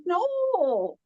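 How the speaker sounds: long, high drawn-out no; retold exasperated protest. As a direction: stretch it out in mock frustration. Frustrated charades with misheard words.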